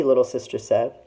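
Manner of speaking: usual speaking voice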